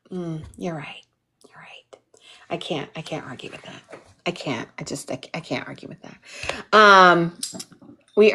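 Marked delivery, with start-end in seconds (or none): 0.1-3.8 hushed, defeated agreement, almost unvoiced; sheepish whisper. Admitting to her assistant, a whispered sheepish concession. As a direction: concede it under your breath. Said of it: "And I was like".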